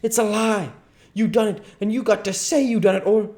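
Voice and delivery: very high, loud outburst; furious demand. Acted. Duke throttling the king for a confession, furious. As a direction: force the confession out of him.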